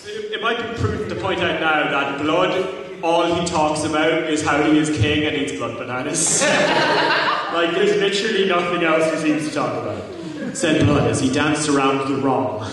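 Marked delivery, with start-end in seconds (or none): none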